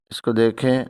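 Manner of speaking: ordinary talk, nothing marked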